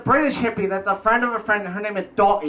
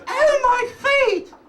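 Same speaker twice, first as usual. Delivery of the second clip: very high, very slow whine; hammed-up whining friend; whiny complaint; much slower than usual. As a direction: wail about sore feet like a child. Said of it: "She says".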